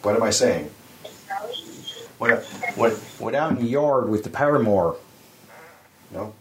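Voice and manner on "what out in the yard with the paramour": "paramour" for power mower, Jersey shore accent; Benny Jersey accent. Shown as a demonstration. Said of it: "That's what it sounds like".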